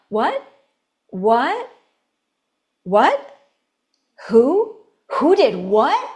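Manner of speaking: each "What" and "Who" rising sharply; short questions, every "What" lifted at the end. Shown as a demonstration. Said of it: "Questions go up".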